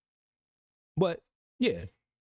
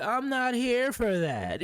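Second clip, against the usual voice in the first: slow, higher mock of the chat's denial; mocking quote; 6 semitones higher, slower than usual. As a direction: parody them saying it, drawn out. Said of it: "You said".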